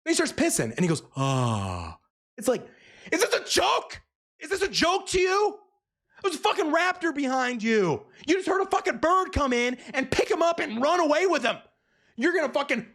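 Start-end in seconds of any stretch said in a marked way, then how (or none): 1.2-2.0 long, low falling "ah"; mock relieved sigh. Boyfriend starts peeing, mock relieved sigh. 3.1-12.8 high-pitched exasperated yelling; incredulous outrage. Real, not acted. Reviewer berating the oblivious boyfriend, outraged yell.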